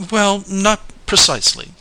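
high, lilting sweet reply; sweetly. A narrator's reading. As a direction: answer sweetly, pitched well up.

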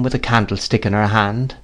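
plain reading voice

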